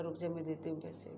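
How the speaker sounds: little sister quietly reassuring her brother; retold hushed reply. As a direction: say it quietly so the conductor won't hear. Little girl answers brother on bus.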